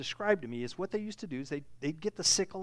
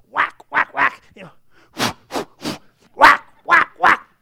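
shouted whack whack, much higher and louder; 8 semitones higher, 18 dB louder than usual. Acted. Preacher acting out Gideon's threshing, loud. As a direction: bang out each whack like a stick. Acting out beating wheat loudly.

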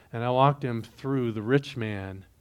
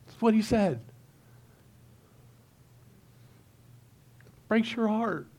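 real emotion lifting the voice higher; misty, halting and high-pitched; choked up; 9 semitones higher than usual. Real, not acted. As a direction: fight back tears, with long pauses between phrases. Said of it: "I just get a little misty".

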